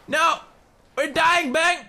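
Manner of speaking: theatrical dying-in-the-snow panic; hammed-up panic. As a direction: wail it like a melodramatic death scene.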